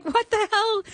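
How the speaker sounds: high-pitched mock shock; stunned disbelief. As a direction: re-enact being floored, pitch way up. Retelling her shock at agents' news.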